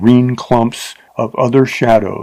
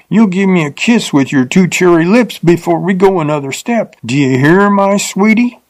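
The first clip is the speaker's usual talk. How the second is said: put-on higher, leering drunk demand; a drunk rider forcing a kiss; drunken leer; 7 semitones higher than usual. A narrator's reading. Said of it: "with a silly leer".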